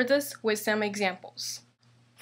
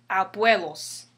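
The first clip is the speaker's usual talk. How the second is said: "abuelos" stressed on BUE; stress on second-to-last syllable; 3 semitones lower than usual. Shown as a demonstration. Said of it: "Second to the last".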